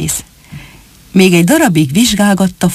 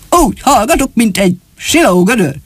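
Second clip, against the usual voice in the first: proud retort, pitched up at the start; self-respecting pride; 4 semitones higher than usual. A narrator's reading. Shoemaker answering doubts about his secrecy, proudly. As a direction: vouch for yourself with dignity. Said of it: "Önérzetesen felelte".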